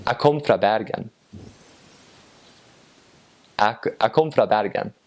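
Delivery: Northern Norwegian, words chopped short; Northern Norwegian dialect. Shown as a demonstration. Showing how you would say it up north, Northern Norwegian dialect. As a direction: say it in lazier North Norway dialect. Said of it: "in the north, you would say".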